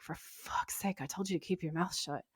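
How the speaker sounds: hushed aside under the breath, mostly whispered; softly seething, meant not to be overheard; silent exasperation. Retold or imitated. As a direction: imitate an exasperated inner thought, kept very soft. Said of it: "just silent in the background".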